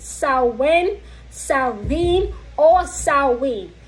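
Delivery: "Samhain" said SOW-win, then SOW-vin, then SOW-win again; "Samhain" run through its variants, the middle one with a v; three pronunciations. Shown as a demonstration. Listing the three accepted ways to say "Samhain" for viewers. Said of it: "Samhain has three pronunciations".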